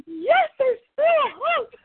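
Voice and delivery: high, loud, slow hopeful cry; thrilled cry. Retold or imitated. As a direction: cry out with sudden hope. Retold thrilled reaction.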